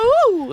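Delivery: very high, loud goofy whoop; silly clown. Pretend. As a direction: squeal a cartoon radio ooh. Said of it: "like all the sound noises".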